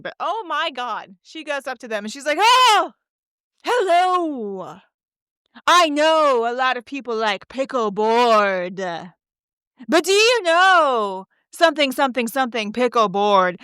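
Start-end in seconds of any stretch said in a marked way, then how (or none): 2.4-13.6 mocking woman impersonation. Woman asking the famous speaker a question, mocking impersonation. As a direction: stretch the words out, sending up a self-important questioner. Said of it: "That is a one hundred percent spot on impersonation".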